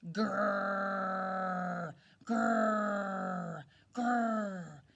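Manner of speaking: loud rough growls; growling. A narrator's reading. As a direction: growl like a tiger in the dark. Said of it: "It went".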